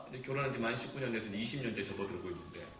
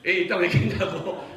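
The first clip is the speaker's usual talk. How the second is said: demanding question; 4 semitones higher, 10 dB louder than usual. Retold or imitated. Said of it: "그러니까".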